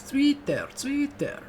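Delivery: Zwitter sung out high, German z as ts; imitating the song. Quoting the chorus of the song he loves. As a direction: copy the singer's high Zwitter. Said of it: "how they say it in the song".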